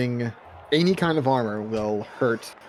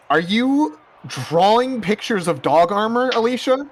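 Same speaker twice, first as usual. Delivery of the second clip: exasperated; 10 semitones higher, 5 dB louder, slower than usual. Acted. Exasperated question to Alicia.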